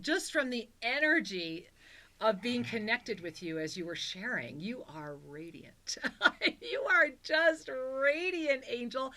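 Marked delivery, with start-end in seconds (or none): 4.6-9.1 slow, awed and tearful; moved to tears by her glow; tender awe. Real, not acted. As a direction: savor the words with emotion. Moved by caller's radiance.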